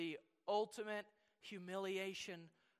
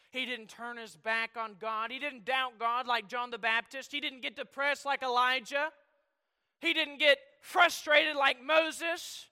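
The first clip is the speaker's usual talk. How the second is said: building preaching; 5 semitones higher, 12 dB louder than usual. Acted. Preacher building a list about Christ, rising to loud. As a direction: climb steadily to a ringing cry.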